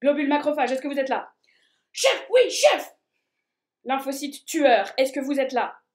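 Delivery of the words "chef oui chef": high, loud soldier reply; soldier shout. Acted. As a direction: snap to attention and shout. Soldier answers roll call.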